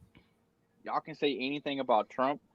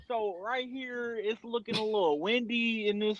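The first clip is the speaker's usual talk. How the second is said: newscaster voice; 5 semitones higher than usual. Pretend. Joking weatherman to podcast mates, newscaster voice. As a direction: play a TV weatherman pointing out the map. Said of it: "I was doing a newscaster voice".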